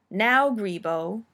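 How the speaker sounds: loud, slow warning; loud, drawn-out final warning; stern command. A narrator's reading. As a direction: stretch it into a firm order to a pet. Nanny commanding the disobedient cat.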